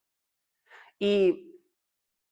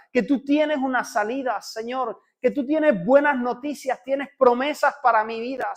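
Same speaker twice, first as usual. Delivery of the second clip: higher and louder, building fervent plea; impassioned prayer; 5 semitones higher, 6 dB louder than usual. Acted. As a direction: cry out to God for a miracle. Prayer rising to full intensity. (oración apasionada, más aguda y fuerte)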